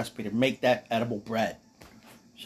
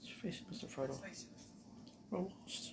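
hushed character voice; 5 semitones higher, 19 dB quieter than usual. Acted. Voicing Sam to Frodo.

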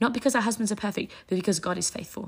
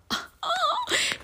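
a short, high happy laugh; delighted laugh. Real, not acted. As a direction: laugh with joy. Delighted laugh after teaching.

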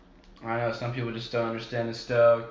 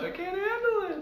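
squeaky overwhelmed squeal; high squeal. Retold or imitated. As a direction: squeal like you're flustered. Gushing, retold self-quote.

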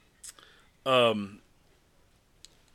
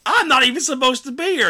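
high panicky plea; 13 semitones higher, 9 dB louder than usual. Retold or imitated. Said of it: "He's like".

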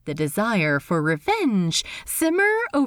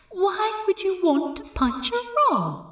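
higher, teasing imagined inner voice; Steed's voice; 10 semitones higher than usual. Acted. Steed's imagined voice chiding Ed about punching, high and teasing. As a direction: chide him as the nagging voice in his head. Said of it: "a voice in his head that sounded suspiciously like Steed".

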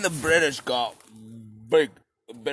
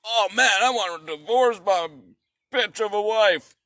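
mock Henry; 6 semitones higher than usual. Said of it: "he was like".

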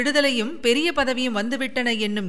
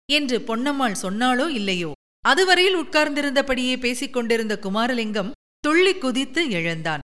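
ordinary delivery, nothing marked

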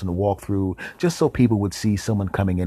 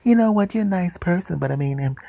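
high-pitched mock female voice; woman brushing him off; 9 semitones higher than usual. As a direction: let him down in a put-on girly voice. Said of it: "And she goes".

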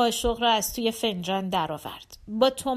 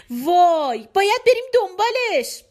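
high, loud burst of eagerness; excited little girl; 9 semitones higher, 7 dB louder than usual. Acted. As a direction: exclaim like a thrilled child. (با هیجان و صدای زیر بچگانه)

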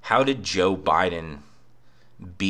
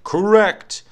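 much higher and louder cartoon squeal; Plankton voice; 14 semitones higher, 9 dB louder than usual. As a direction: blurt it like a tiny shrill cartoon villain. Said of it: "And then Plankton's like".

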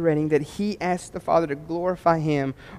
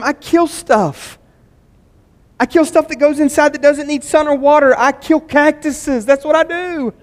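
higher and louder, driving sermon climax; full-force preaching; 10 semitones higher, 9 dB louder than usual. Acted. Pastor urging his church to serve, preaching loudly. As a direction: preach it out to the whole room.

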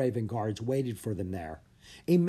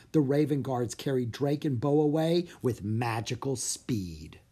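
ordinary delivery, nothing marked

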